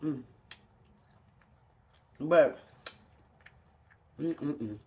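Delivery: ordinary delivery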